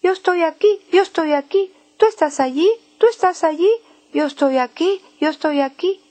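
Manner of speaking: high, sing-song chirped call; birds calling. Acted. One bird calling to the other, high sing-song chirp. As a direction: chirp the words like a little bird. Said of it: "y uno dice al otro".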